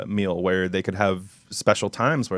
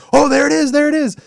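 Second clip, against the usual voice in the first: high, loud, thrilled cry; excited guests; 8 dB louder than usual. Retold or imitated. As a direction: squeal like a delighted crowd. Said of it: "pressing that button going".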